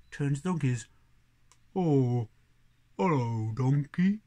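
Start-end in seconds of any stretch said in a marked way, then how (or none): 1.7-4.2 low, loud mooing voice; talking cow. Acted. Cow turns to the donkey at midnight, low mooing greeting.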